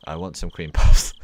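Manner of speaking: sudden loud craving; loud blurt. Real, not acted. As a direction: blurt it out loudly.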